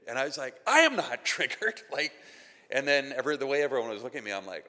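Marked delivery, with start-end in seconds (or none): 0.7-1.8 loud, high, indignant denial with a laugh breaking in; hammed-up losing it while insisting otherwise; triggered. Preacher re-enacting his reply to being called triggered, mock outrage. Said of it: "when you're triggered, you don't really know that you're losing it".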